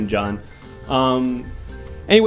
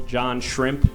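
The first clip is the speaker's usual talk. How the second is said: ordinary talk, nothing marked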